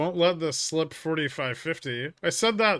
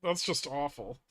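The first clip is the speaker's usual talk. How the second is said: usual speaking voice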